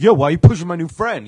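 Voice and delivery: mock tough shout. [name] confronting [name] over pushing his friend, mock tough shout. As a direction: call him out, street-tough.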